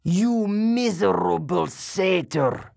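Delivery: slow furious monster snarl; enraged roar. A narrator's reading. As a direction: spit the insult in rage. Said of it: "Medusa roared with rage".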